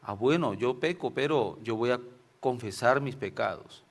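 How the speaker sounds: ordinary talk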